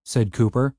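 ordinary delivery

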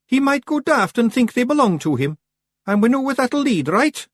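very high, loud Scottish figure; Billy Big Chin worrying. A narrator's reading. Billy Big Chin passing on Jeannie's fear, high-pitched worry.

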